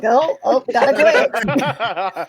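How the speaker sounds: loud, dragged-out glitchy groan; dying camera voice. Retold or imitated. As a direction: act out a camera breaking down. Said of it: "his camera's like".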